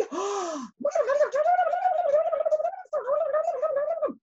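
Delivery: high, breathless gasps and squeals; excited gasping babble. Acted. Butterfly and dragonfly babbling too fast to understand, high gasping squeals. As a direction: gasp and squeal like a frantic insect.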